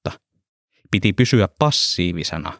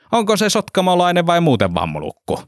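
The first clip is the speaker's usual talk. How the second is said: mocking driver; 10 semitones higher than usual. A narrator's reading.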